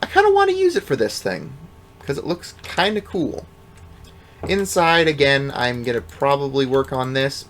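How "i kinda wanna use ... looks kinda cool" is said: high mock self quote. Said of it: "and I was like".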